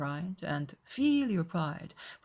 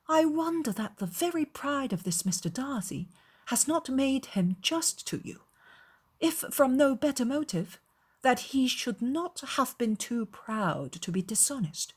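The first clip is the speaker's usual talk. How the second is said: put-on higher-pitched, emphatic young lady; disapproving, pitched-up reasoning; indignant Elizabeth; 8 semitones higher than usual. A narrator's reading. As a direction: argue it out in appalled wonder. Reader voices Elizabeth's indignation.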